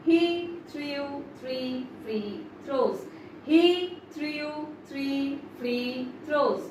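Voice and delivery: slow and careful, every sound drawn out; a slow practice take of "He threw three free throws"; slowly. Shown as a demonstration. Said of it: "আস্তে আস্তে বলবে".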